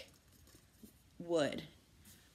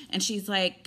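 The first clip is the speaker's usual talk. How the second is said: normal talking voice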